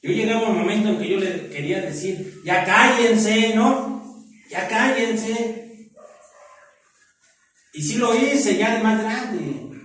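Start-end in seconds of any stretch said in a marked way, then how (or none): 2.4-5.9 pleading call to stop. Retold or imitated. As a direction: stretch it out, begging them to stop. Wanting to silence fighting parents. (súplica lenta y alargada para que se callen)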